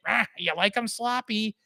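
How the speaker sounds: high, lightly voiced lunch lady; a hint of a cafeteria lady. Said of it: "lunch lady voice".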